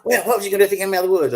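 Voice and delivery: high, fast mock scolding; scolding wife. Wife chewing out her husband in the truck, fast high mock scolding. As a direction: rattle it off as the fed-up wife. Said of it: "I'm getting an earful".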